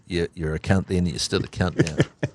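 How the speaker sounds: hushed and low, slowed right down; whispered. Retold or imitated. Coach whispering his triumph at the reporter. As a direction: lean in and whisper it slowly. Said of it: "he's just whispering".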